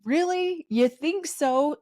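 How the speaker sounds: high, slow, incredulous scoff; sarcastic disbelief. Retold or imitated. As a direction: drip sarcasm as you doubt him. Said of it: "and I'm just like".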